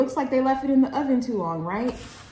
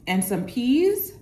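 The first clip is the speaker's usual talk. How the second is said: usual speaking voice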